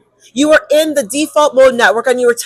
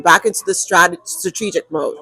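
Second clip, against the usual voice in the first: ordinary talk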